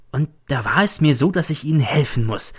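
ordinary delivery, nothing marked

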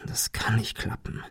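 tonlos geflüstert; flüstere es nur für dich; zu sich selbst. A narrator's reading. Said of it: "jedoch mehr zu sich selbst". (leises Flüstern zu sich selbst)

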